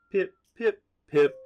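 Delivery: hoppip cries. Acted. GM repeats Hoppip cry.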